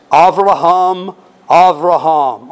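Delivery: "Avraham, Avraham" said the Hebrew way, ah-vrah-HAHM, slow and drawn out; Hebrew pronunciation. Shown as a demonstration. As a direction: proudly give the name its Hebrew sound, slowly. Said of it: "Aren't you glad of my Hebrew?".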